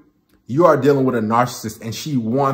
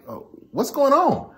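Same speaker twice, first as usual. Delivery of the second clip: high, worried question; mock concerned boyfriend; 11 semitones higher, 3 dB quieter than usual. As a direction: pitch it up, puzzled and caring. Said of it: "And you're like".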